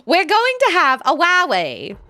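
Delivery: "wowie" said with w for r, high and loud; small mouse voice saying "wowie" for rally; can't say her R's. Retold or imitated. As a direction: lisp the R into a W like a little mouse. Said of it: "she can't say her R's".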